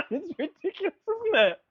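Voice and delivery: high, amused disbelief; laughing. Real, not acted. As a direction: squeak it out amused. Laughing over absurd story.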